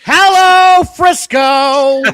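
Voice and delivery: loud high arena greeting; announcer greeting. Retold or imitated. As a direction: greet the crowd like a ring announcer.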